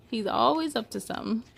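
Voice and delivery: usual speaking voice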